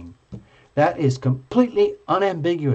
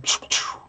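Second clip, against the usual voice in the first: whispered toy laser pews; pew sound effect; 5 dB quieter than usual. Retold or imitated. Gamers firing lasers, whispered pew pew. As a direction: mimic a tiny blaster, under the breath. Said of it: "could be going like".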